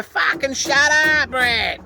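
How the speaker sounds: high, slow retold yell; slow angry shout, high pitched; yelling out the window. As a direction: bark it at the dog through the window. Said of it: "I yelled out the window".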